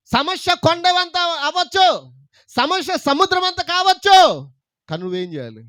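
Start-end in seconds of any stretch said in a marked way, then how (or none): 0.1-4.5 high, loud, slow build; preaching climax. Acted. Preacher building to a loud slow climax for the church. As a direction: stretch every word out.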